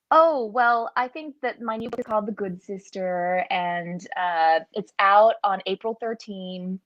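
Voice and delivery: Australian putting on an American accent; American accent, performed on request; bad American accent. Shown as a demonstration. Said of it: "a very bad American accent".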